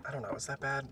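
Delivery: ordinary talk, nothing marked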